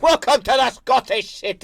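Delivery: high-pitched hammed-up Scottish cry; exaggerated Scottish accent. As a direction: do your best over-the-top Scottish welcome. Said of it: "that's my best Scottish accent".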